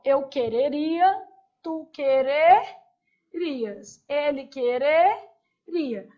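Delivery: quereria forms drawn out; slow drill. Shown as a demonstration. Teacher showing the conditional to the class, slowly. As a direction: stretch each form slowly. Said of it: "Então, aqui, ó".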